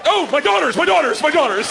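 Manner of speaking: high, loud anguished wail over the daughters; wailing lament. Acted. Comic dad wailing about his daughters, mock anguish. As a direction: cry out in mock despair.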